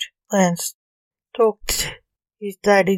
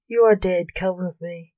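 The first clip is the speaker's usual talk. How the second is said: usual speaking voice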